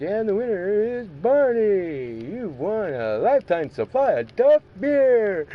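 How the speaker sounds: loud, high, swooping announcer voice; hammed-up game-show host bellow; contest announcer. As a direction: stretch out the big prize reveal. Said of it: "and they're like".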